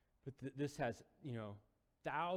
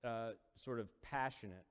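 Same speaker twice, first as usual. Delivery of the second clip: normal talking voice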